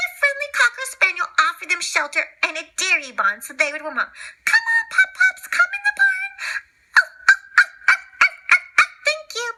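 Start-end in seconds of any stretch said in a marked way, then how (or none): 4.4-6.3 friendly spaniel. Acted. Cocker Spaniel invites them. 7.0-9.5 grateful puppies. Acted.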